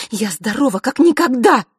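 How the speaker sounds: высокий громкий злой ответ; огрызнись зло и громко. A narrator's reading. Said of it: "зло произнесла Василиса". (злой громкий ответ)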